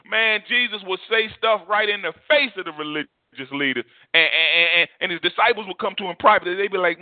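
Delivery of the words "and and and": normal talking voice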